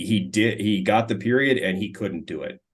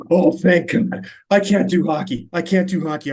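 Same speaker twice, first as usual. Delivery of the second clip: relieved outburst; 9 semitones higher, 6 dB louder than usual. Retold or imitated. Brad relieved to hand over mic.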